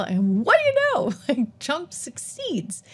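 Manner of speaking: "what do you know" jumps way up, then dissolves into a laugh; laughing gloat. Real, not acted. Host mocking Krugman's failed prediction, laughing gloat.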